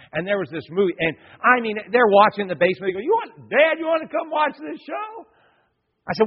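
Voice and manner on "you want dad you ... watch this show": put-on high, loud kid voice calling upstairs; kids calling dad. Pastor voicing his kids calling him to the movie, high and loud.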